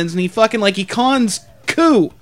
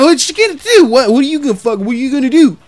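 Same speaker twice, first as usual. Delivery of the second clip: mock confrontational taunt, pitched up at first; retold yelling challenge; 3 semitones higher than usual. Drunk man in the manager's face, mock yelled challenge. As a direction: imitate a drunk man squaring up. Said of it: "He's yelling".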